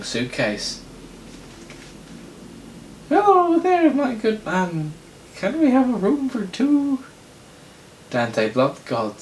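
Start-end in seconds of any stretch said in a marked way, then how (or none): 3.1-7.0 very high, loud falsetto character voice; piercingly high cartoonish put-on voice; Anthony Falcon. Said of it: "Anthony Falcon".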